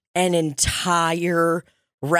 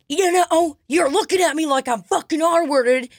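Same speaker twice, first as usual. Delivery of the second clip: mock angry man; 9 semitones higher than usual. Imitating the rude man yelling at her, mock rant. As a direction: play the guy losing it, hammed up.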